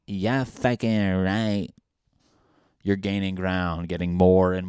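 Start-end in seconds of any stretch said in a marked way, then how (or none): 0.1-1.7 put-on moody girlfriend. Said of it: "she's like".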